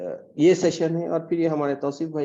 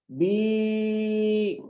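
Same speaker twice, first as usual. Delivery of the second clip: बी held long on one breath; drawn-out syllable; 5 semitones higher than usual. Shown as a demonstration. Teacher holding बी long to show one syllable. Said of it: "one syllable".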